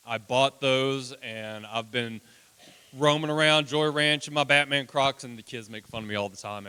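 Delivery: ordinary talk, nothing marked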